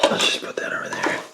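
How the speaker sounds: whispered aside to himself; whispering. Real, not acted. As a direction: breathe it under your breath. Muttering to himself while working.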